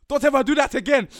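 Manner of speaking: loud, high screaming scold; grandma screaming. Retold or imitated. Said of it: "Screaming at me".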